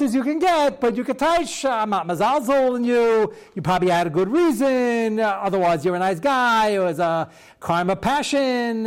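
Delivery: hammed-up comforting of a murderer; mock soothing rabbi.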